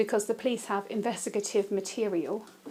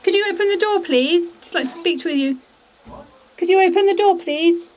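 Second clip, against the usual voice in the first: loud, high call through a door; apologizing through a door; 8 semitones higher, 9 dB louder than usual. Real, not acted. Calling through door to old man.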